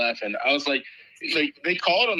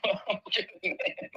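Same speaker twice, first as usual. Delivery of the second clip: laughing; 2 semitones higher, 8 dB quieter than usual. Real, not acted. Laughing after Penguin joke.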